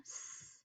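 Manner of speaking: s hissed with no voice; whispered s sound. Shown as a demonstration. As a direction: breathe out a soft s. Teacher sounds out a letter.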